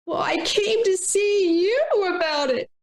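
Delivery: high, slow whiny plea; a whiner pleading in a high, slow put-on voice; whiny reply. A whiner pleading to a coworker, high and slow. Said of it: "whiner answers in role".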